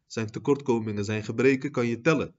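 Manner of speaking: ordinary talk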